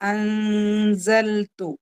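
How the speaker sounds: slow drawn-out Arabic recitation of അൻസൽതു; Quran recitation demo. Shown as a demonstration. Teacher reciting the word for students, slow and drawn out. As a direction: chant അൻസൽതു slowly, stretching each vowel. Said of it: "clear ആയി വിചാരിക്കുക".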